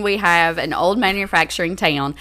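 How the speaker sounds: ordinary talk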